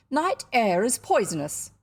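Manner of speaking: loud, emphatic warning; stern pronouncement. A narrator's reading. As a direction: declare it as indisputable law. Aunt lays down the law.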